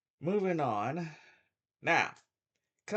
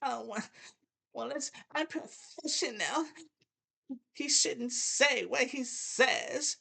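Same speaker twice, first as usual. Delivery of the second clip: mocking critic; 11 semitones higher than usual. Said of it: "if people says".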